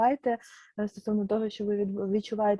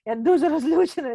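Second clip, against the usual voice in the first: loud, higher angry quote; louder, sharper angry quote; voicing anger; 4 semitones higher, 6 dB louder than usual. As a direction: voice her fury loudly. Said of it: "Я кажу".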